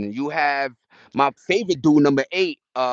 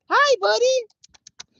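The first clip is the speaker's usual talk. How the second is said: talking to a pet; 5 dB louder, much slower than usual. Real, not acted. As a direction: coo at the little critter. Baby-talks to a squirrel.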